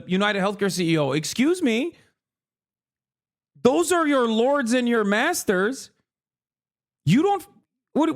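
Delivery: ordinary talk, nothing marked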